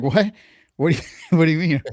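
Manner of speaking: high, fast, laughing what-do-you-mean; laughing fake innocence. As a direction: play dumb through a laugh. Caught lying, feigns innocence.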